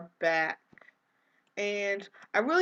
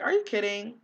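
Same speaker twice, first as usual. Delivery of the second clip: ordinary delivery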